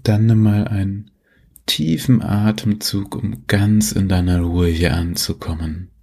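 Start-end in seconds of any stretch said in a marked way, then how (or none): none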